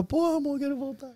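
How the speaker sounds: high, soft pleading to a partner; mock a small pitiful voice begging to come home; pitiful little plea, retold; homesick whine. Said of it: "já tava".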